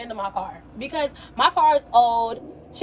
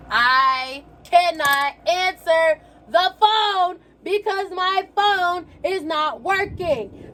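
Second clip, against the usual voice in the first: slow, word by word, pitched up; exasperated over-enunciation; 6 semitones higher, much slower than usual. Retold or imitated. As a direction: spell it out slowly to someone who won't get it. Exasperated retold reply to dad.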